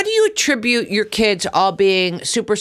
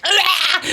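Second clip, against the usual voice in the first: high exasperated "ah" with a laugh; laughing high outcry; mock outraged cry; 10 semitones higher than usual. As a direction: yelp in disbelief, half laughing. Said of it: "I'm like".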